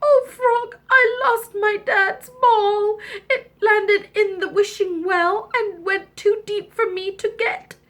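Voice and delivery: high, tearful princess wail; hammed-up distress, on the edge of sobs; crying princess. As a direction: whine tearfully for help. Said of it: "she cried and she cried".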